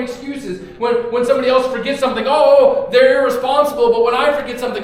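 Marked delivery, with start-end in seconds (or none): 2.3-3.9 mock outrage. Mocking judgment, loud.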